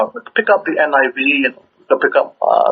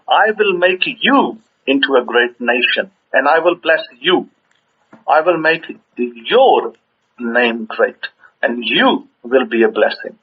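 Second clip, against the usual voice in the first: slow verse reading, "you" and "your" stretched; deliberate scripture reading that spotlights "you" and "your"; stress on you and your; slower than usual. Shown as a demonstration. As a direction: lean hard on each "you" and "your", pausing after. Demonstrates the pronoun while reading verse.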